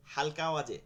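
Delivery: ordinary talk, nothing marked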